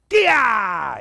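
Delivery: full-chest kia yell; sharp karate yell; kia shout. Shown as a demonstration. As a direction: shout it out from the belly. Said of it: "Hands together".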